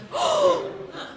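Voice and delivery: sharp, very high mock gasp; a hammed-up gasp of shock when no reply comes; dramatic gasp. No reply to I love you.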